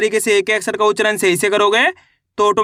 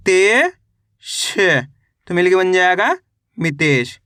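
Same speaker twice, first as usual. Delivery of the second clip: slow classroom sounding out, syllable by syllable; patient teacher reading to children; syllable drill; slower than usual. Acted. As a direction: spell each letter, then blend the word.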